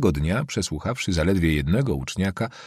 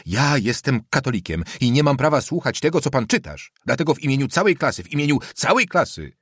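higher, cutting tone; cutting, rising protest; 6 semitones higher than usual. A narrator's reading. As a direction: build the pitch into a defiant declaration. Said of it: "mówił coraz głośniej tonem, który ciął niby damasceńska szabla". (coraz wyższym, tnącym tonem)

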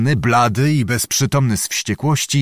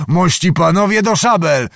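call to arms; 6 semitones higher, 4 dB louder than usual. A narrator's reading. Calling men to arms.

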